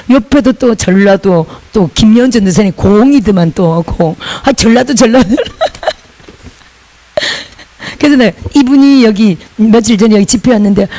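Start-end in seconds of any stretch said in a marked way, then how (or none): 5.3-5.9 laughter. Real, not acted. Laughing about Jeolla-do.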